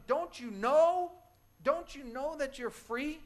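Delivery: high, slow, loud plea to the prisoner; yelling to a prisoner who stays in an open cell, loud and slow; pleading yell. Retold or imitated. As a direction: call out slowly, pleading with them to see they're free. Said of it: "You would wanna yell to them".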